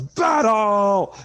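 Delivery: high, loud warrior's charge shout; drawn-out in-character war cry; yelled battle cry. Acted. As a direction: bellow it as you rush the enemy. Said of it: "so Goro rushes and yells".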